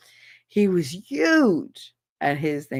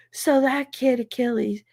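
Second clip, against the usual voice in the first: higher-pitched imitation of an excited boy; dramatic schoolboy retelling; kid acting it out; 6 semitones higher than usual. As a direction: act out the attack like a kid. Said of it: "act the whole thing out".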